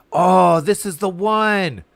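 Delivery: loud, drawn-out delighted exclamation; excited recognition. Real, not acted. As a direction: exclaim it high, stretching the words. Recognizes the outfit in game.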